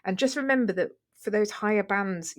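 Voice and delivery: normal talking voice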